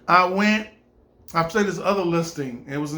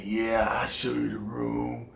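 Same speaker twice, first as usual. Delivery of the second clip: put-on very slow, low, soft old man's drawl; creepy old man; 8 semitones lower, 6 dB quieter than usual. The old landlord greeting LaDon, a slow low creepy voice. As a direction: creep out the visitor.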